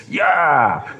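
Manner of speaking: high breathy laugh into exactly. Real, not acted. Agreeing with a friend, breathy high laugh.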